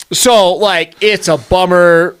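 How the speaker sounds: ordinary delivery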